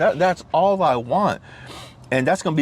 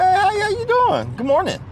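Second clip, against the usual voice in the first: be super friendly at the drive-thru; cheery greeting. Retold or imitated. Greeting the Dunkin' Donuts lady, bright high-pitched hello. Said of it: "I was like".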